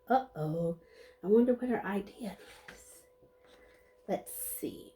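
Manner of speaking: hushed, teasing whisper. Acted. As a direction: lean in and whisper it to the kids. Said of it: "whispered aside to listeners".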